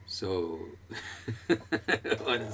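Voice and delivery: amused, breaking into chuckles while talking; laughing. Real, not acted. Teacher amused that another song turns up, laughing. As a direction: laugh through the words.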